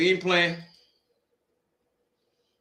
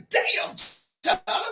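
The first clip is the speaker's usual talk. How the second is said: mock exclamation; 9 semitones higher, 4 dB quieter, slower than usual. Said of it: "I say".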